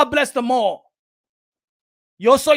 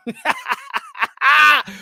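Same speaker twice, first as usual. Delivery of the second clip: mocking laugh. Real, not acted. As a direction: laugh in a rapid string after the insult. Laughs after insulting his critic.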